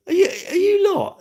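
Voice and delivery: high, loud squeak of disbelief; squeaky mock voice. Real, not acted. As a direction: squeal the question up top. Mocking viewer, voice jumps.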